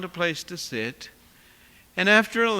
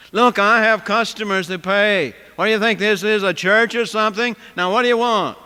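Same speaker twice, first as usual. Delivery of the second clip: louder, angry cook; getting loud; 3 semitones higher, 8 dB louder than usual. Retold or imitated. Cook berating a woman who only wants water, angry and loud. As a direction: lean on her, impatient and loud. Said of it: "he got real loud about it".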